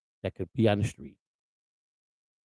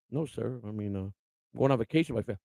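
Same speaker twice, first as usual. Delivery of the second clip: much softer, timid driver answering the sheriff; scared driver answering the sheriff, soft and timid; meek reply; 2 semitones lower, 9 dB quieter, faster than usual. Retold or imitated. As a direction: shrink back and answer politely. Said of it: "That's how they talk to you".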